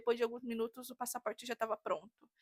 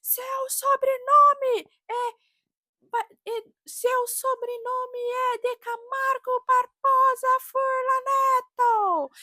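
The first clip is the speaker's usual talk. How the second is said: much higher, louder and slow, a mocking impression of a screaming clerk; imitating a berating yell; 13 semitones higher, 10 dB louder, slower than usual. The consulate clerk screams at the husband over the phone, mimicked furious yelling. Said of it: "o cara começou a berrar no telefone".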